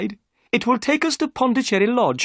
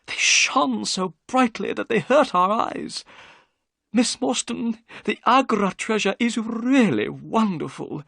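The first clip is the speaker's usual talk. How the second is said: a touch of excitement, higher; mildly excited storyteller; 12 semitones higher than usual. A narrator's reading. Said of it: "Thaddeus Sholto's voice became excited".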